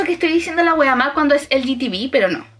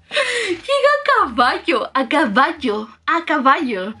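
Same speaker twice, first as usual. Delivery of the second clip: laughing disbelief; 2 semitones higher, slower than usual. Real, not acted. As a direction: drag it out through laughter. Mock-dramatic about the horse. (llorando de risa, aguda y lenta)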